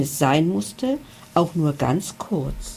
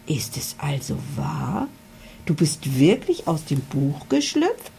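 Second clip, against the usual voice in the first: astonished; 2 semitones lower than usual. A narrator's reading. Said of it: "fragte Emily verblüfft".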